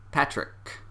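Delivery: normal talking voice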